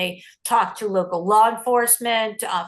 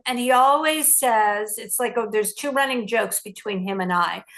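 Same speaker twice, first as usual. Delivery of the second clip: ordinary talk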